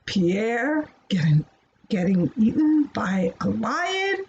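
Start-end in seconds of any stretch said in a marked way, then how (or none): none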